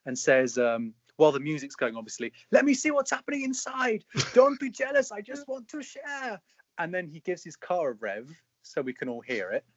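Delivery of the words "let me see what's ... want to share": much higher put-on voice; high, playful show-off voice; imitating the footballer. Footballer in his car, voiced higher and playful. Quoting the video's lines.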